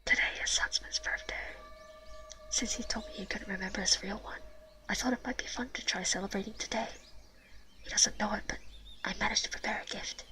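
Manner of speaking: story read in a whisper. Real, not acted. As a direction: hush every word. Reads game text whispering.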